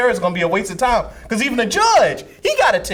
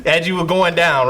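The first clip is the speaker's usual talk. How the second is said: ordinary talk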